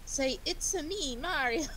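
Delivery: high, drawn-out cartoon Italian accent; Mario's Italian accent. Teasing an Italian friend with a Mario catchphrase, mock Italian accent. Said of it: "with the, with the accent".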